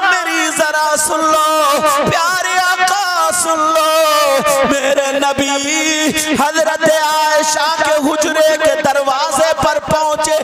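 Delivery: loud, chanted sermon storytelling; preaching at full force. Acted. Preacher to the congregation, telling the Prophet's story, fervent chanting preaching. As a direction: preach it with swelling fervour, half sung. Impassioned sermon over music.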